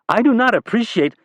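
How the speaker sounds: an aide bristling before being cut off; indignant staffer. Acted.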